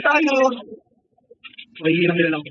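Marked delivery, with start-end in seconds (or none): 1.8-2.4 a dismissive low murmur; low mocking aside. Real, not acted. Streamer sneering at weak trolls, low and mocking.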